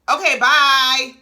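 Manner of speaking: a loud, drawn-out, slow brush-off; dismissive. Real, not acted. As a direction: wave her off, slowly and loud. Dismissing Mel's music talk.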